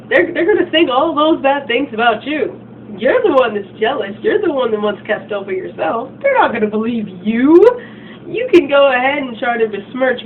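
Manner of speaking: high, loud sneering taunt; mock her confidently. Mean girl taunting rival.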